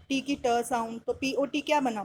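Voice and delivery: ordinary talk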